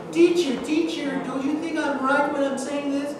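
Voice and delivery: put-on high pleading child voice, teacher teacher; a needy pupil begging for approval, pitched high; imitating a child pleading with the teacher; whiny child. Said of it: "don't you see children that do this".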